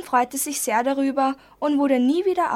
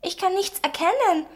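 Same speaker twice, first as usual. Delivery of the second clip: put-on a light sulky defiance in a higher child voice; defiant; 8 semitones higher than usual. A narrator's reading. Girl to the teacher, mildly defiant. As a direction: say it like a stubborn little girl. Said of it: "sagte Linda trotzig".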